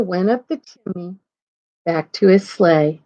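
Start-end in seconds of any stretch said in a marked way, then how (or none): none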